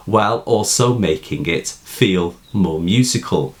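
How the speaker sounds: ordinary delivery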